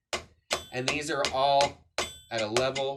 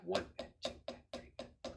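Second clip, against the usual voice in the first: very soft, near-voiceless count; hushed counting; 6 semitones lower, 11 dB quieter, much faster than usual. Shown as a demonstration. Drum teacher showing the tap notes in between, hushed count. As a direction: count under your breath, evenly.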